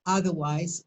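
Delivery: normal talking voice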